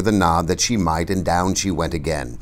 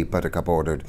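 ordinary delivery, nothing marked

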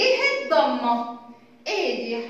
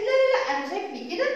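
high, quick huffy protest; high-pitched mock complaint from Alif; upset letter Alif; 4 semitones higher, faster than usual. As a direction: act out an offended letter refusing its shape. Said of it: "الألف زعلت، قالت".

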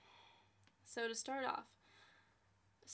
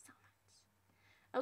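whisper; 3 dB quieter than usual. Real, not acted. Wistful aside, whispered.

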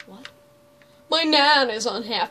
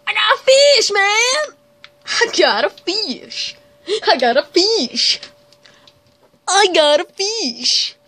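loud, high triumphant yell; excited shout; 6 semitones higher, 11 dB louder than usual. Real, not acted. Player shouting at the screen, thrilled catch. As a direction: yell it out like you just won.

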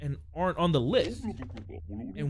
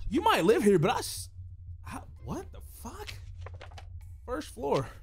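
ordinary talk, nothing marked

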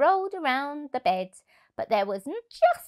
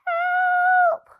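much higher, loud shriek; cry for help; 8 dB louder than usual. Acted. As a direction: scream for help in terror. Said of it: "cried the animals".

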